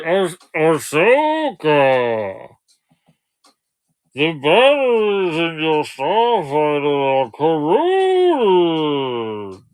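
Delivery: slow, drawn-out character voice; put-on voice for a guessing game; Star Wars character voice. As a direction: stretch every word out like a sci-fi creature. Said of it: "doing the same impression for both of them".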